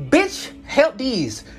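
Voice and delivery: very slow, drawn-out, high put-down; sneering retort. Retold or imitated. To the woman offering help, slow scornful retort. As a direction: stretch each word, scornful. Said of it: "I was finna tell that bitch".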